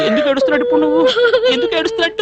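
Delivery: very high-pitched, flustered pleading; flustered protest. Acted. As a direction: plead helplessly in a high, strained voice. Flustered man facing her loud crying.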